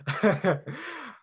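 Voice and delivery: short high-pitched giggle; playful laugh. Real, not acted. Speaker teasing a slightly controversial title, high playful chuckle. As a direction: chuckle briefly, rising high.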